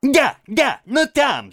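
high, loud, excitable squawk; jabbering Toad. A narrator's reading. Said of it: "затараторил Жабби". (тараторить высоким голосом)

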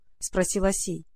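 plain reading voice

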